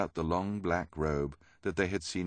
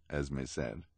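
plain reading voice

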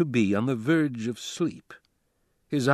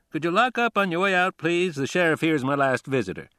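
lightly voiced judge, higher and quicker at first; a hint of a judge, pitched up, making a polite request; judge's dialogue; 7 semitones higher, 3 dB louder than usual. A narrator's reading. Judge speaking to departing bailiff.

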